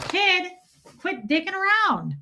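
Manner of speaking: high-pitched telling-off; playful scolding. Real, not acted. Scolding the kid beside her.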